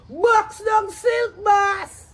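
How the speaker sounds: loud, high, drawn-out command; real angry outburst; angry shouted order. Real, not acted. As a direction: shout it slowly, hitting each word. Angry rant ordering a beating.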